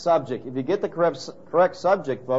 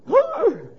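loud, high-pitched theatrical gasp; mock shocked gasp; 5 dB louder than usual. Everybody hears the reformers' news, mock horrified gasp. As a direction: gasp in exaggerated horror.

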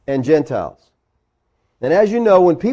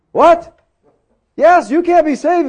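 mock shock; 9 semitones higher, 4 dB louder than usual. Preacher mocks Judaizers' claim.

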